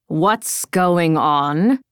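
low, slow, concerned male voice; Quindor. A narrator's reading. Quindor arrives at the barracks, low and slow. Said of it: "Quindor's voice".

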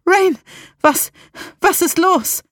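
high, urgent panicked questioning; alarmed. A narrator's reading. Salem asking Rain what is wrong, alarmed. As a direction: call out in sudden alarm. Friend alarmed at narrator's pain.

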